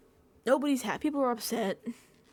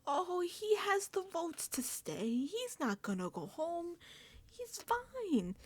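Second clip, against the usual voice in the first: mock dismissive houseguests; 9 semitones higher, 5 dB quieter, slower than usual. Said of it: "they're like".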